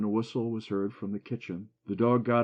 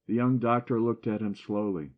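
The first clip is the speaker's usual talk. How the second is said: plain reading voice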